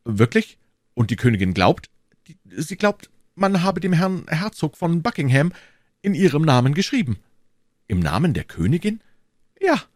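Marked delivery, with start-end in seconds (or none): 2.3-7.2 lightly voiced higher-pitched townsman; a hint of a nervous informant; anxious bourgeois. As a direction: voice a worried citizen, lightly raised in pitch. Narrator plays the bourgeois.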